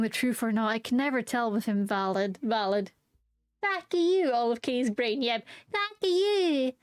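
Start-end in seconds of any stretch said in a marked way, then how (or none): 3.6-4.3 put-on high, sweet anime girl voice; cute anime girl voice. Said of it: "cutest little anime girl voice". 5.7-6.7 put-on high, sweet anime girl voice; cute anime girl voice. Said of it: "cutest little anime girl voice".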